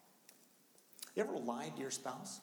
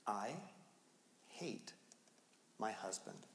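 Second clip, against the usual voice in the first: low, softer, halting read of the words; stunned quiet reading; 5 semitones lower, 6 dB quieter, slower than usual. Retold or imitated. Husband reading his wife's journal aloud, quiet and stunned. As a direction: read it out slowly, shaken.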